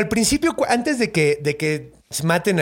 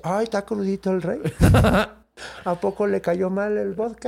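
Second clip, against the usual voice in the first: mocking tease; 4 semitones higher than usual. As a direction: coo at him like a sulky child. Hero mocks hungover king.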